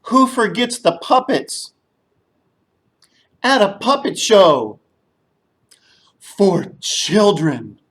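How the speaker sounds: loud call-and-response; group call-out. Shown as a demonstration. Teacher leading the children in a loud call-out. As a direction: lead the kids in a chant. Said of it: "everybody say".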